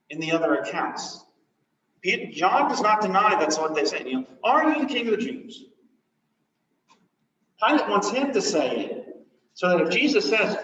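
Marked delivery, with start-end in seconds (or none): 4.4-5.7 Pilate's question. Acted. Pilate questions again, loud.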